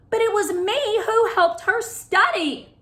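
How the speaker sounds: high, loud indignant protest; outraged. A narrator's reading. Claire protesting to Mister D, loud and outraged. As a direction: let the outrage burst out. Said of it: "outrage in her voice".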